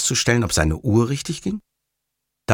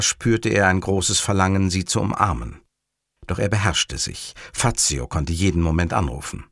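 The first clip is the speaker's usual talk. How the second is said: usual speaking voice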